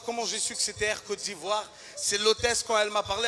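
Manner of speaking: ordinary talk, nothing marked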